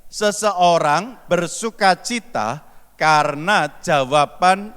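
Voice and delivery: slow, measured reading of a holy verse; solemn scripture reading. Acted. Preacher reading the proverb to the congregation, slow and measured. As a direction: read the verse slowly and deliberately. Reads next verse aloud.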